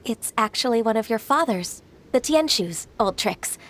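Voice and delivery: ordinary talk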